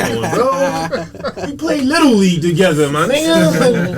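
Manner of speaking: very high, drawn-out comic plea; mock pleading Gary. Gary reminding him of Little League, high mock pleading. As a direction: plead in a squeaky put-on high voice. Playing Gary objecting to being forgotten.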